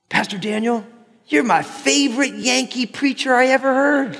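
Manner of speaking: high-pitched mock Southern admirer; imitated Southern churchgoer.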